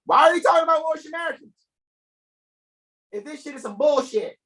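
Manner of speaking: high, fast, shouted disbelief; mock outrage. Host shouting mock disbelief to his stream audience. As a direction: throw the question up loud and fast like you can't believe it.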